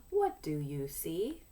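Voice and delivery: ordinary talk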